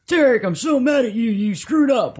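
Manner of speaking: high, loud angry accuser; drill sergeant voice. Retold or imitated. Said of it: "that was a good, like, you know, drill sergeant sound".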